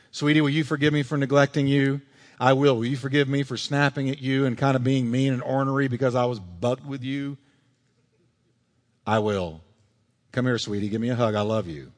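tender apology. Acted. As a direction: coax forgiveness from a spouse. Acted spouses reconciling.

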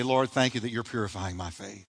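soft prayer. Shown as a demonstration. Leading the church in prayer, hushed and tender. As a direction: pray it gently, hushed. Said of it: "lift your hand and say".

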